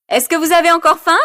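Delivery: Karine chirps high into the mic; high, fast announcer call. Acted. Karine asks the partygoers into the microphone, high and fast. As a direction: ask the crowd brightly and quickly.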